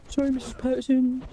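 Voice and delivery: put-on high, sulky teenage boy mumble; whiny teen apology. Apologizing to Missus Patterson, sulky teen voice. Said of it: "the Carty voice".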